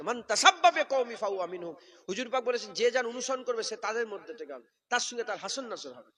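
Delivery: loud sermon declaration; forceful preaching. Real, not acted. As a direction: proclaim it to the crowd.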